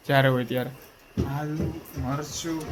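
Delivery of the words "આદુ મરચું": mock mumble of આદુ, મરચું, slow and much softer; imitating slow quiet reply. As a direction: copy her lazy, barely voiced answer, slowly. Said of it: "એટલે હાવ નિરાંતે".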